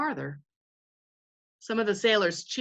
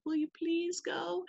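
higher, soft, slow mock plea; pleading ask; 9 semitones higher, 7 dB quieter, slower than usual. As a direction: beg them sweetly, much softer and higher. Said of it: "So rather than saying".

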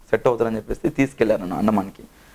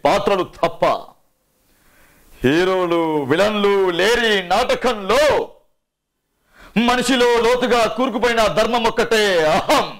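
much higher, intense film-climax monologue, drawn out; Sai Kumar impression; 9 semitones higher than usual. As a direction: declaim a tragic hero's climax dialogue, building to anguished peaks. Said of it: "Sai Kumar గారి voice అంటే".

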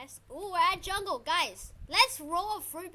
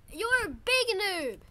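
loud, high, slow jeer; taunting yell; 6 semitones higher, 8 dB louder, slower than usual. Real, not acted. Player rubbing it in to a rival, taunting yell. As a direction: stretch out the insult loudly.

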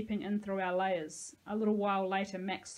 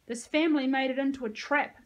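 loud, higher angry accusation; snarled; 5 semitones higher, 7 dB louder than usual. A narrator's reading. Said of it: "Sahalia snarled".

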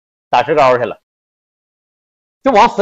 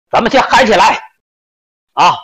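party shout; 6 semitones higher than usual. Retold or imitated. Ringleader shouting to the room.

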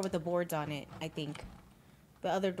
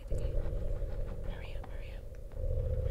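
slow urgent whisper; being chased; much slower than usual. Real, not acted. Being chased, urging partner.

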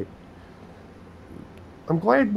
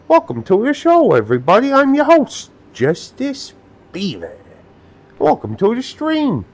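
loud, high showman greeting; excited host welcome; 11 semitones higher, 7 dB louder than usual. Acted.

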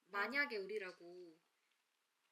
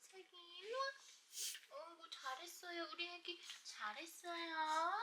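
squeaky praising a dog; 7 semitones higher than usual. Real, not acted. Cooing to the dog.

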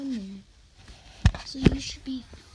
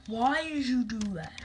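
ordinary delivery

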